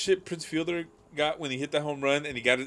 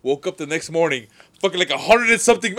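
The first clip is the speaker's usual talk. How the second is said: ordinary talk, nothing marked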